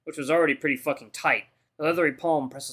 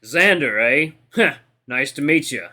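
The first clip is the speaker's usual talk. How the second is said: loud, amused echo of a name; big brash fighter greeting a rookie; cocky veteran fighter; 6 dB louder, slower than usual. A narrator's reading. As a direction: mull it over loudly, unimpressed.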